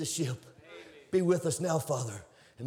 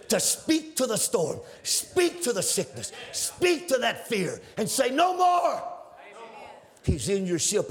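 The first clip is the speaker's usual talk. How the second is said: exhorting preacher; 3 semitones higher, slower than usual. Acted. Preacher urging believers to rebuke their storms, shouting. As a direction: command them to speak to the storm.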